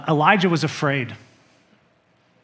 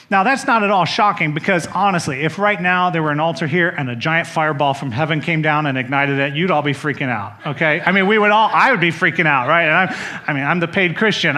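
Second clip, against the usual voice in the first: ordinary talk, nothing marked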